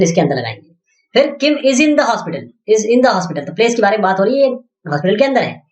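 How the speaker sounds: usual speaking voice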